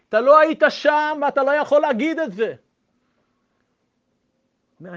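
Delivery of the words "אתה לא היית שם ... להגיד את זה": loud, higher stern rebuke. Retold or imitated. Survivor scolds the rabbi for wanting to say it, loud and stern. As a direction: scold him hard and firmly.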